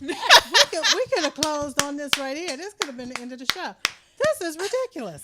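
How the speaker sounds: very loud, high, excited praise; overwhelmed raving. Real, not acted. Host saying it could have closed the show, raving. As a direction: rave at full volume, blown away. Raving about the poem just heard.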